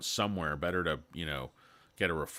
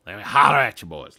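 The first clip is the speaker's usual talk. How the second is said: holler; 2 semitones higher, 14 dB louder, faster than usual. Acted. Calling out to the chat.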